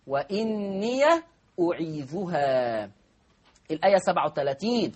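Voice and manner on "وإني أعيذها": "وإني" mit geöffnetem Ya, langsam gedehnt; "وإني أعيذها" langsam, Ya mit Fatha; Warsh-Lesart vorgeführt. Shown as a demonstration. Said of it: "قرأها ورش بفتح ياء الإضافة".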